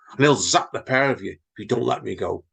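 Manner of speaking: ordinary delivery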